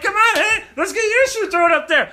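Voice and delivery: put-on loud, high old-time New York tough guy; laughing gangster brogue; 1930s beat cop. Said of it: "he's like".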